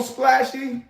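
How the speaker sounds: usual speaking voice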